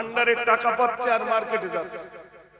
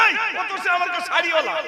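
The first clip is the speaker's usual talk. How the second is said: high, fast indignant snap; indignant high-pitched telling-off; scolding mother; 8 semitones higher, 4 dB louder, faster than usual. Acted. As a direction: scold the child in a raised, rapid voice. Acted scolding mother in a story.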